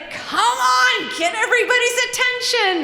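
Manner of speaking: high, loud shout; shouting urging. Retold or imitated. Johnny shouting for attention while banging the ice bucket. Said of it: "and said".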